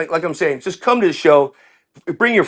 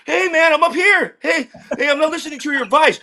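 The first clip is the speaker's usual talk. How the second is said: much higher, laughing taunt from up high; gloating brag; 12 semitones higher than usual. Retold or imitated. Skydiving student taunting the instructor from the top, high gloating crow. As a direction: crow at the instructor like a smug kid.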